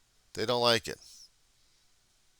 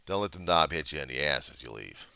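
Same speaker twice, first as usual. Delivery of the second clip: ordinary talk, nothing marked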